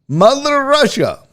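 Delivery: bad, hammed-up Russian accent, high and slow; terrible Russian accent. Streamer to chat, hamming a bad Russian accent. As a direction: ham up a cartoon Russian accent. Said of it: "It was a terrible accent".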